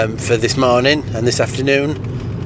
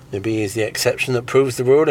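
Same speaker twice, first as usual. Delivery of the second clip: ordinary delivery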